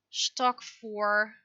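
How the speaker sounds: ordinary delivery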